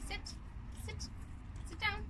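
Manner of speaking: high firm command; firm dog command. Real, not acted. Telling Cooper the dog to sit, high firm command. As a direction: tell the dog to sit down firmly.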